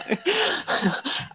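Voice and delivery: high rolling laugh. Real, not acted. Guest laughing while objecting to the word, high laugh. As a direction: keep laughing through the protest.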